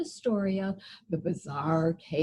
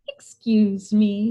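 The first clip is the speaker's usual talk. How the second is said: lightly voiced cheerful old woman, slow; a hint of sunny sweetness; old woman greeting brightly at the door; 9 dB louder than usual. A narrator's reading. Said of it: "she said brightly".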